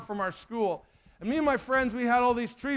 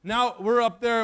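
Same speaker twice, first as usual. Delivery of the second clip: ordinary delivery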